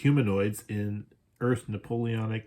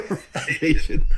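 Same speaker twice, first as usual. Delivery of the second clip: louder, higher through a laugh; laughing repeat; 6 semitones higher, 7 dB louder than usual. Real, not acted. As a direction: Improvisation repeated while cracking up. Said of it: "laughs Improvisation".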